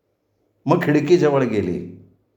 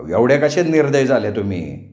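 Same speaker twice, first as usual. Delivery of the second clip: usual speaking voice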